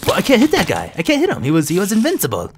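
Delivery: high, loud, flustered outburst; agitated protest. Real, not acted. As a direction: protest loudly at the unfair fight. Protesting an unbeatable enemy.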